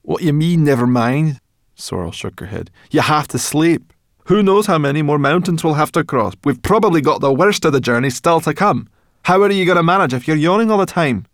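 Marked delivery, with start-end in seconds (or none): 0.0-1.4 loud, high indignant retort. A narrator's reading. Sorrel challenges the yawning dragon, indignant. 2.9-11.3 loud, high nagging tirade; scolding. A narrator's reading. Sorrel orders Firedrake to sleep, scolding.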